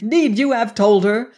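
pained, impassioned outburst; despairing grief. A narrator's reading. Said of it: "cried Venters in despairing grief".

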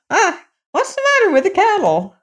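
put-on high-pitched old woman, alarmed question; high exclaiming old-woman voice; old mother crying out. A narrator's reading. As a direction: ask shrilly high, as a fretting old mother. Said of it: "cried his mother".

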